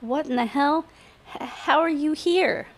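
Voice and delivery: high, startled and stammering; shocked. A narrator's reading. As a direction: blurt it out in alarm. Startled by intruder in room.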